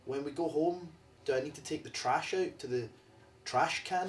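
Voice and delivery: put-on a light American accent, as a Scottish kid would put it on; mock American kid asking about the trash can; imitated American kid accent. Scottish kid asking mom in American accent. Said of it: "speaking in American accents".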